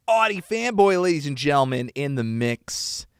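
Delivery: loud hype-man shoutout; hype announcer. Acted. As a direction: announce him like a show host. Hyped announcer introduction.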